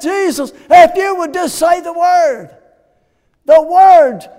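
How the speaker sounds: high, loud, pleading cry; loud plea. Acted. Centurion begging Jesus to speak, loud pleading cry. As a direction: cry it out as the climax.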